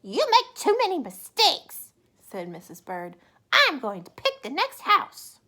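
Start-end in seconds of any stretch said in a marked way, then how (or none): 0.0-1.9 high, loud snap; Missus Bird scolding. Acted. Missus Bird correcting her husband, high and loud. 3.5-5.4 high, loud snap; Missus Bird scolding. Acted. Missus Bird correcting her husband, high and loud.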